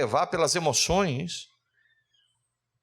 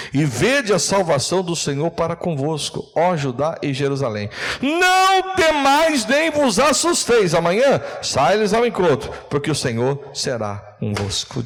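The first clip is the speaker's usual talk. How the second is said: pregação inflamada; 6 dB louder, slower than usual. Acted. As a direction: build to a drawn-out cry, then come down. Proclama o versículo com toda força. (proclamação bíblica bradada)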